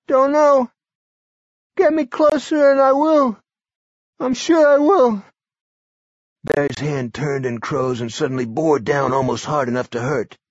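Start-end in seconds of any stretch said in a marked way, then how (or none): 0.1-5.2 put-on high, loud, strained old man; a feverish old man pushing through; sick old man. A narrator's reading. Barry voiced.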